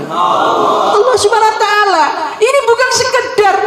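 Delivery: ringing sermon shout; preaching shout. Real, not acted. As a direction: cry it out to the congregation. Preacher's rising climax.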